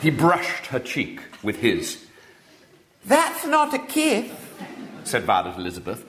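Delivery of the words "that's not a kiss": put-on high, slow childish lisp; lisping little girl. A narrator's reading. Little girl asks William's name, lisping high and slow. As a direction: lisp it like a spoilt small girl.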